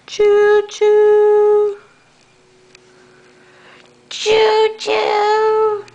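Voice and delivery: high, loud choo-choo like a train whistle. Retold or imitated. Mother showing her toddler what a train says, high and loud. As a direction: call it out like a toy train. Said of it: "What's a train say?".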